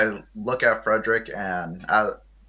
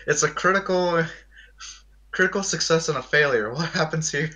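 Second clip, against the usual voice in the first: excited; 7 semitones higher than usual. Real, not acted. Excited player rolls crit and fail.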